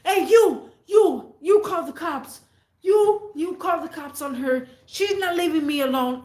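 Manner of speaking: high, loud mock shout at a stranger; retold yell. Boyfriend yelling at the man fixing his car, a high, loud mock shout. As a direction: bark orders at the neighbour to call the cops. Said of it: "He's like".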